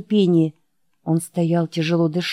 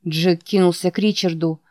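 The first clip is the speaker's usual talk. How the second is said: ordinary delivery, nothing marked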